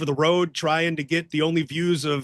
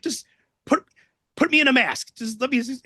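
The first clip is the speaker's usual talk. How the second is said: high begging; eager pleading; 7 semitones higher than usual. Retold or imitated. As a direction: plead to be let in. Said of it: "he's like".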